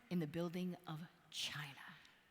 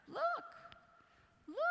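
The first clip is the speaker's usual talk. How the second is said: excited look. Retold or imitated. Nudging boy to see yak.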